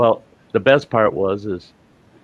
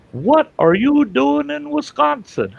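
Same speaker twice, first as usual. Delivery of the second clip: much higher, louder and slower, a long hollering call; yelling across the bar; 5 dB louder than usual. Retold or imitated. Little guy shouting at the teller from across the bar. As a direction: holler it across the room like you've spotted an old friend. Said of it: "he yells at the top of his lung".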